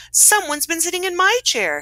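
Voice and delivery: high-pitched storybook mother bear; Mama Bear. A narrator's reading. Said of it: "said the Mama Bear".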